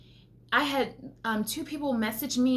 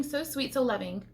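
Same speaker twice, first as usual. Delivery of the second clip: ordinary delivery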